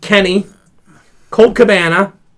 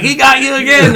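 laughing quote; 7 semitones higher than usual. Retold or imitated. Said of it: "he's like".

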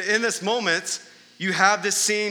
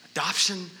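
usual speaking voice

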